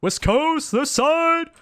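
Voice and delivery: high, loud hammed-up West Coast shout; mock West Coast call. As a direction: yell it like a playful West Coast gang call. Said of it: "really eastern or western".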